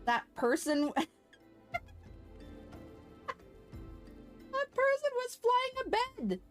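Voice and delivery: breaking into laughter, then squealing high; amused disbelief. Real, not acted. Streamer cracks up at a player flying a bed, laughing squeal.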